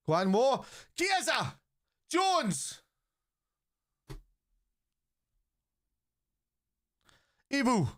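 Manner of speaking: high, excited match shouts; excited calling out. Real, not acted. Fan watching the match shouts players' names excitedly. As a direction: cheer the players on from the couch.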